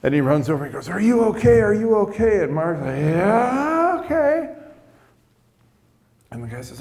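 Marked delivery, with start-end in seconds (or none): 0.9-2.4 high, worried check on him; acted-out anxious question, pitched well up; urgent concern. Injured driver rushing over to the drunk driver, urgently concerned. 3.0-4.5 drawn-out, high tipsy reply; drunk guy. Retold or imitated. Drunk driver answering after the crash, dazed and tipsy. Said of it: "being the drunk guy".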